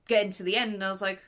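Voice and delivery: usual speaking voice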